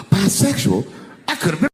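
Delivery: hammed-up horror after a sharp gasp; mock horrified. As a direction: act appalled by the news. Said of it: "I was horrified".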